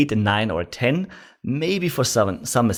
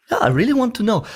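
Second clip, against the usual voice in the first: high, sincere curiosity; really want to know; 11 semitones higher, faster than usual. Shown as a demonstration. Salesman showing a prospect real curiosity. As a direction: sound like you truly want to know. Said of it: "like you actually really want to know".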